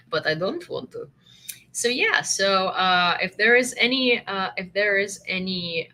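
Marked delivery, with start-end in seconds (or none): 0.1-1.1 quiet, low, lightly put-on Russian accent; a low mock Russian accent, played down. Shown as a demonstration. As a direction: tease in a mild Russian accent. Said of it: "sound very Russian".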